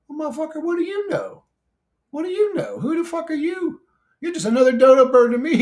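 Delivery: high-pitched, indignant mock retort; mock indignant comeback. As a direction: fire back at a critic in a high, squeaky-high pitch. Talks back to imagined critic.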